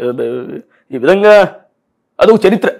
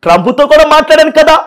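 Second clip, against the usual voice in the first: Paul impression; 5 semitones higher than usual. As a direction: speak up high like the pastor-politician. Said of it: "ఇప్పుడు Paul గారు చేస్తాం".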